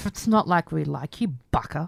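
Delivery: lightly played tsundere, pitched low; a hint of grudging tsundere denial. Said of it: "The tsundere".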